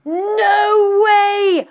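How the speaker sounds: high, loud delighted cry; excited shout. Real, not acted. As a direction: shriek with real delight. Hears of listener's Disneyland trip.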